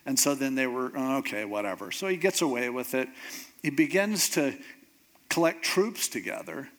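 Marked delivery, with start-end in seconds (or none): 0.9-1.9 low, offhand giving in; imitating a grudging concession; mock dismissive. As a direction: shrug it off, dropping lower and softer. Crowd grudgingly backing down.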